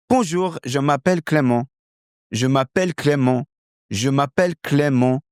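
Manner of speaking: French accent as a Frenchman introducing himself; native French accent. Shown as a demonstration. Teacher practising as Clément, native French accent. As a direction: introduce yourself with French intonation. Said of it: "now I'm adopting that character".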